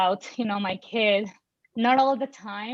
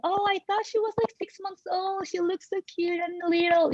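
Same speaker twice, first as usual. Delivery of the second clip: condescending parent; 8 semitones higher than usual. Retold or imitated.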